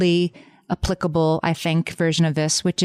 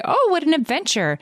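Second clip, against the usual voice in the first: high, gushing imitation of other people; a bright, sing-song mock of well-meaning people; 11 semitones higher than usual. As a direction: gush it like a nosy acquaintance. People gushing about her past.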